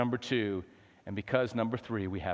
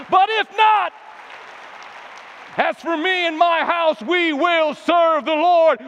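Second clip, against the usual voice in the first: full-force preaching; 13 semitones higher, 11 dB louder than usual. Real, not acted. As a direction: roar it out as a defiant vow.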